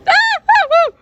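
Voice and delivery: high, loud staccato screams; acted scream. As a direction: scream in short high bursts. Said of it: "We all screamed".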